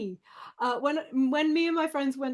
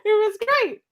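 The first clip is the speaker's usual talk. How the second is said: much higher and louder, bursting with glee; gleeful; 11 semitones higher, 11 dB louder than usual. Real, not acted. Remembering the snake costume outing to friends, gleeful. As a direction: belt it out, thrilled by the memory. Delighted recall of the night.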